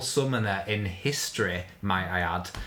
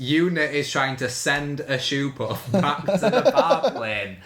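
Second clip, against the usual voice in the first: laughing while talking; 5 semitones higher, 4 dB louder than usual. Real, not acted. Laughing mishearing of far plane.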